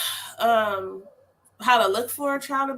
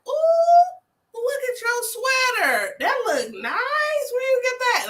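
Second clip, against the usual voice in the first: put-on high, slow, delighted praise for a child; cheerful redirecting; 13 semitones higher, 4 dB louder, slower than usual. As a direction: gush over his sweater to distract him. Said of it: "And I was like".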